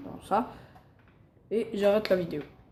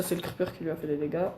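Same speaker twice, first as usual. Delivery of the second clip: normal talking voice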